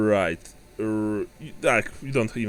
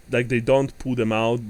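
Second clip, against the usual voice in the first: usual speaking voice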